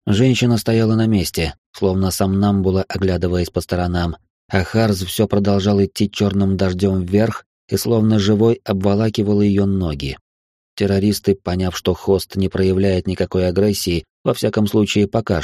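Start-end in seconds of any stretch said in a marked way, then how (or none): none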